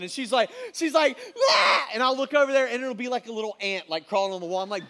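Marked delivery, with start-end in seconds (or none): 1.2-1.8 high, loud mock shriek; husband mimicking his wife screaming at an ant; wife's scream. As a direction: shriek like she's seen a monster. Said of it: "she'll be screaming at the top of her lungs".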